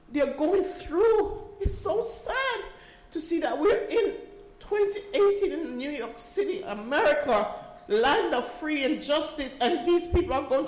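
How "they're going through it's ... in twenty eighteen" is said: slow, high, tear-choked words; tearful. Real, not acted. Mother pleads for families of the jailed, choked with tears. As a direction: voice cracking as she pushes the words out. Crying over prisoners' families.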